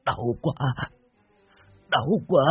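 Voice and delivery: pained whimper climbing to a slow high wail; whimper, then beg in drawn-out cries; weeping. A narrator's reading. Dying man in pain pleading with a spirit, weeping. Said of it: "mà khóc lóc".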